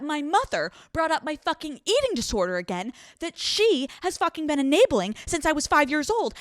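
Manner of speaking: high, loud furious rant. Retold or imitated. Girlfriend raging at Frederick about her mother, high and loud. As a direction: unload every grievance at him.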